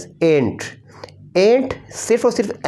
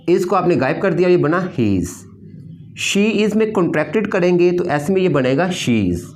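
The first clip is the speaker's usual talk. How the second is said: normal talking voice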